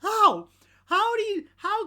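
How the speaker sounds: high, loud cry of disbelief; really can't believe it, yell it; how? shouted high in disbelief; incredulous shout. Real, not acted. Outraged disbelief at the news.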